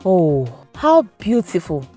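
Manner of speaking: usual speaking voice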